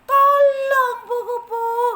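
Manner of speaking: high, slow, loud hopeful call; excited cry for help. Acted. Ant spots the butterfly and calls out. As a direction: spot your rescuer and shout. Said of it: "katanya seperti itu".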